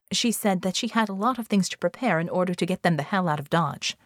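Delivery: ordinary delivery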